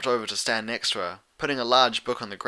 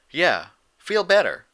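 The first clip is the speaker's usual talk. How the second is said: louder, slower country echo; Applejack; 7 semitones higher, 6 dB louder, slower than usual. Acted. Applejack echoing Pinkie while watching Fluttershy, slow and pointed. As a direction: drawl it back with meaning. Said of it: "Applejack echoed".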